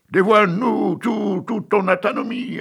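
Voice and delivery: high, loud, slow leering demand; crowd shouting. Acted. Music hall crowd heckling Miss Univers, slow loud leer. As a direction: drag it out like a lewd heckler. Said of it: "Mais les voilà qui crient".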